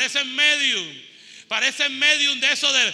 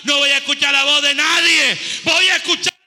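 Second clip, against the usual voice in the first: loud, higher, building preaching shout; thundering prophet voice; 3 semitones higher, 8 dB louder than usual. Acted. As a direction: build to a roaring declaration. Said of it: "Levante su mano y diga conmigo". (voz de profeta atronadora)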